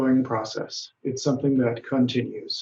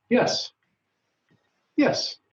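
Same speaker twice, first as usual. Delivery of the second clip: much higher, eager double yes; 9 semitones higher than usual. Shown as a demonstration. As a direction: answer brightly, like a keen student. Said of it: "Li Wu says".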